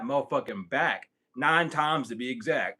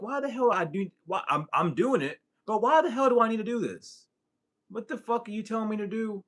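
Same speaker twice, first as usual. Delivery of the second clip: exasperated protest; 7 semitones higher than usual. Retold or imitated. Acted protest to the guides.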